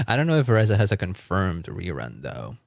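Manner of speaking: ordinary talk, nothing marked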